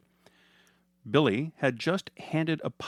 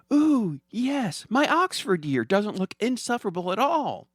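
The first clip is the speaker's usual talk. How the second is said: put-on high, eager little girl's yes; a child thrilled by the movie pick; brightened up; 11 semitones higher, 4 dB louder than usual. Daughter answering mom, high and excited. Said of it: "Linda's eyes brightened".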